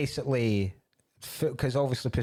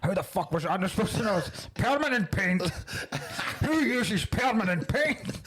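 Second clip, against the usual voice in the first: higher, half-hammed mock yell at a henchman; mock shouting; 6 semitones higher than usual. Bond mock-shouts at the henchman about the paint, hammed up. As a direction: rant like an outraged spy. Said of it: "Bond starts shouting at odd job".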